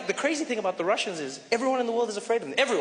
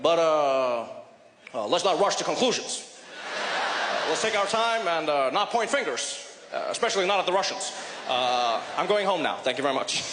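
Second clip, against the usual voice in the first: hesitant Obama; 4 semitones lower than usual. Retold or imitated. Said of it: "Obama was like".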